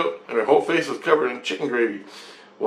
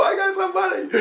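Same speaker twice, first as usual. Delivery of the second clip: mock kid voice.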